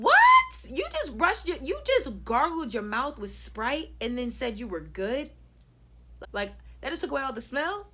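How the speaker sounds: loud, high shriek easing into incredulous question; outraged disbelief. Retold or imitated. Acts outraged reaction to gargling.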